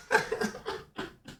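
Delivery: high breathless hyena giggle. Real, not acted. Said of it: "je fais un petit rire de hyène".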